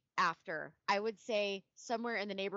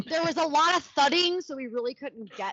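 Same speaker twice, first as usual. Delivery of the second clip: high, loud mocking; mock the lame excuse; mock sarcasm; 8 semitones higher, 7 dB louder than usual. Mocking the testimony.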